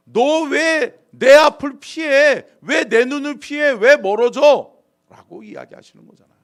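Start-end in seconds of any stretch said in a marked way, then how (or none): 0.1-4.7 loud, high, drawn-out demands; God's anguished reproach; reproachful shout. Acted. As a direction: confront someone who avoids you, pleading at full voice. God confronting the hiding Adam.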